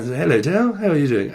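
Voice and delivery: proper British English, slightly lifted pitch; Mary Poppins-like British. Shown as a demonstration. Reader showing how locals speak, proper British accent. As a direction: put on a prim English greeting. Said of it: "we speak more like Mary Poppins".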